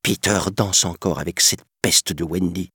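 ordinary delivery

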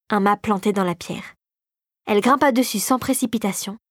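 ordinary delivery

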